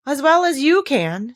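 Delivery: a haughty flower snapping back; proud retort. Acted. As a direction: toss it back with pride. Tiger lily retorts proudly.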